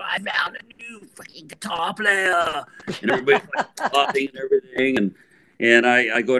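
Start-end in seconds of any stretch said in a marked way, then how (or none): 0.0-2.7 mock yell. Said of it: "he yells out".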